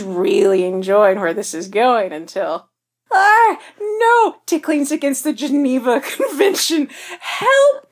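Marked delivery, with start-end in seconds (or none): 3.1-7.9 high squealing through laughter; laughing shrieks. Acted. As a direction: shriek while being tickled. Darcy being tickled, laughing.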